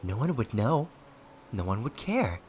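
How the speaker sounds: high, swooping, mocking lilt; childish taunting. A narrator's reading. Possessing ghost daring his enemy to kill, childish taunting. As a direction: needle him like a bratty child. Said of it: "He taunted with childish noises".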